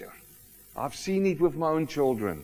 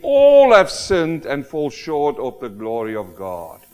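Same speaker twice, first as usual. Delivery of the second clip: "All" loud and stretched, then the rest slow; stress on ALL; 2 semitones lower, 9 dB louder than usual. Real, not acted. Preacher declares to congregation all have sinned, emphatic long ALL. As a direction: hit the opening "All" hard and hold it. Preacher proclaims verse's key word.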